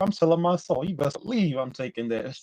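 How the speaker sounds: normal talking voice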